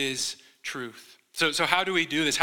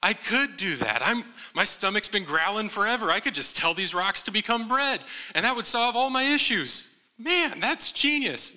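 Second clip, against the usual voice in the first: mock excitement; 6 semitones higher than usual. Jesus pretending to fall for Satan, building mock excitement. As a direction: pitch climbing ever higher, hammed-up delight.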